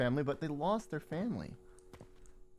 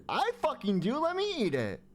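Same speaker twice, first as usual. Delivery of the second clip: high whiny voice; 9 semitones higher, 6 dB louder than usual. Pretend. Arguing with himself, high.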